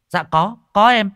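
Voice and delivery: high, loud hurried reply; eager answer. Acted. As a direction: answer the professor quickly. Kim answering the call.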